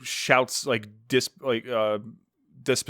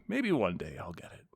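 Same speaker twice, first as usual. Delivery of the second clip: softer, wistful repeat of maybe one day; dreamy hush; 4 semitones lower, 8 dB quieter than usual. Real, not acted. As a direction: let it fade like a longing.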